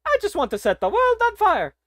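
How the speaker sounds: very high, loud cartoon plumber voice; Mario voice. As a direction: belt it out as the cheerful plumber, way up high. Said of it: "Mario voice impression says".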